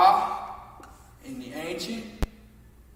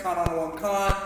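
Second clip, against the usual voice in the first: fast slurred version; faster than usual. Shown as a demonstration. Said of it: "when you hear it say it real fast".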